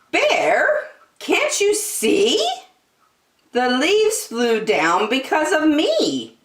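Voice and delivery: high, airy wind voice. Acted. The wind teasing Big Bear, high and airy.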